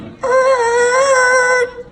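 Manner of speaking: high put-on grunt of refusal; a sharp high "er" pushing him away; high dismissive grunt; mother's refusal noise. Mother rebuffs father's kiss.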